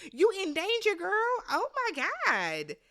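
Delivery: put-on high, playful "girl, you're in trouble" call; mock warning. Telling Danielle she is flipping on allies, mock warning.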